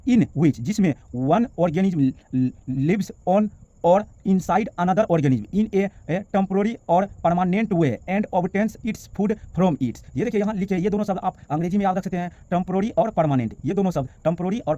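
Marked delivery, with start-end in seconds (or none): 0.1-10.2 very slow, careful English reading; slow dictation. Shown as a demonstration. Teacher dictating the English definition slowly to the class. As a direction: read each word slowly so it can be copied.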